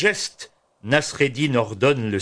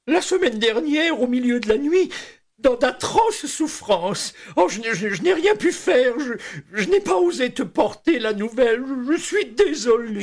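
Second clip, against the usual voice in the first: higher, hammed-up mourning voice; mock grief; 12 semitones higher than usual. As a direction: overplay fake sorrow. Nasreddin feigns grief.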